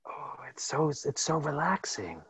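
soft whispery murmur; relaxing ASMR voice. Pretend. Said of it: "get this voice confused".

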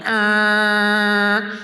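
a loud, grating movie-style "arr" noise; annoying mock noise. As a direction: imitate the most annoying sound ever, hammed up. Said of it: "the outside guy on Dumb and Dumber going".

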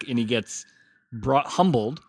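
ordinary delivery

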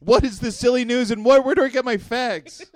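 high, loud mock bewilderment; loud cartoonish confusion; silly voice. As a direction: demand it in a high, hammed-up voice. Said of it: "It's all these silly voices".

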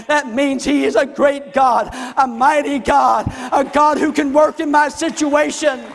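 loud, high proclamation; full-force preaching. Real, not acted. Preacher proclaiming a great, mighty God, loud and forceful. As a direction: shout God's greatness to the congregation.

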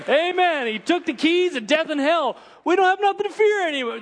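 very high-pitched, impassioned proclamation; soaring revival-preacher cry; full-force preaching. Real, not acted. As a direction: preach it at the top of your range.

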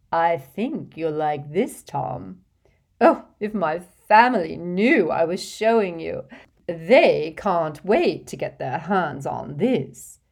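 put-on fussy, gushing rich old lady, laughing in; a vain old witch showing off to a charming boy; giggly hostess voice. Hepzibah gushing to Tom.

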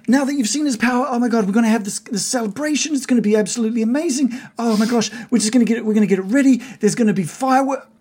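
high, breathless-with-glee gushing; super excited. Acted. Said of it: "Todd is super excited. He's like".

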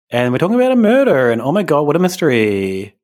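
put-on high, gushing Valley Girl accent, swooping up; ditzy Valley Girl gasp at a murder; hammed-up Valley Girl mock. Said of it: "I did the Valley Girl voice".

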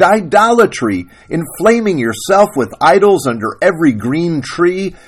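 loud, high-pitched righteous tirade; screaming acted denunciation; screamed. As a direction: rail at him like an outraged wife. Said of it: "Sarah Ruth screamed".

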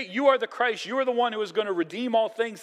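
high, fast, eager proclamation; fired-up declaration. Retold or imitated. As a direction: blurt it out, all fired up. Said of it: "they get fired up".

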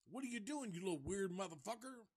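high, fast mock outrage at the intruder; imitating L G. L G catches Chop Top in the records, high and fast. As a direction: squeak it out like a startled weirdo. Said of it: "and he's like".

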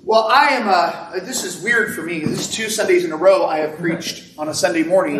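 usual speaking voice

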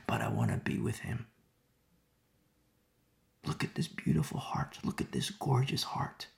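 soft loving whisper; hushed adoring whisper; tender whisper. Real, not acted. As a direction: whisper in awe of her devotion.

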